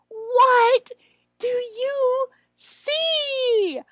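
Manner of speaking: high, very slow, loud question; teacher voice. Shown as a demonstration. Trainer repeats the prompt question, slow and high. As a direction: draw the question out for the child. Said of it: "circle the panda again and say".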